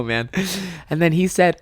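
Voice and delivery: usual speaking voice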